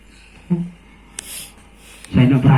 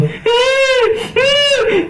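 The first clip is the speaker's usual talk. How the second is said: very high, drawn-out fake wail; high-pitched pretend sobbing howl; mock female wailing. Shown as a demonstration. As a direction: imitate a woman crying in a long squeal. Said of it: "Kalau mungkin laki perempuan itu menangis".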